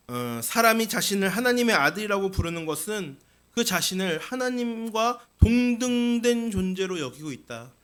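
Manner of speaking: normal talking voice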